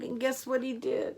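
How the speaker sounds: ordinary delivery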